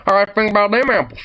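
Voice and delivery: folksy saying. Pretend. Reacting to Alabama trivia, lightly put-on folksy quip. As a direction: toss off a country saying.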